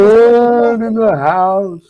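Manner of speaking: slow, drawn-out high announcer call; announcer hype. Pretend. Cohost announcing the arriving guest, slow hype call. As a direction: stretch it like a ring announcer.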